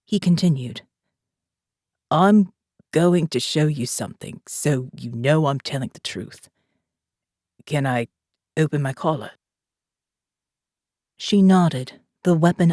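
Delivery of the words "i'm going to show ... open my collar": put-on calm commander, a light South African accent; faint South African accent. A narrator's reading. Jake speaks to Morgan.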